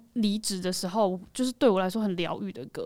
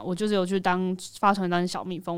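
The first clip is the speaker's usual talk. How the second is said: normal talking voice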